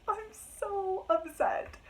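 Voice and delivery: high, slow whimper; a high, slow complaint about the book; upset whine. Real, not acted.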